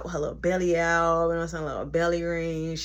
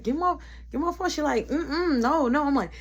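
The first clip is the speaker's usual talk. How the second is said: pleading; 8 semitones higher than usual. Retold or imitated. Pleading to get phone back.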